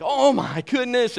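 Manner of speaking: high, loud, drawn-out mock amazement; acted elated whoop, slow and high; whooping and hollering. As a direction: holler it like an overjoyed disciple. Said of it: "like, hooping and hollering".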